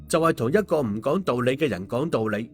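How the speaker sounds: usual speaking voice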